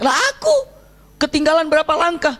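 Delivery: high-pitched lament of being left behind; acted dismay. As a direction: exclaim it high, in dramatised regret. Companion laments falling behind others. (keluhan menyesal bernada tinggi)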